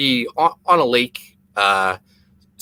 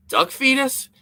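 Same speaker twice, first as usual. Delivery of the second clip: Duck fetus? with the pitch jumping way up on fetus; incredulous question; 12 semitones higher, slower than usual. Retold or imitated. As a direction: repeat it back in disbelief, voice leaping high. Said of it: "I was like".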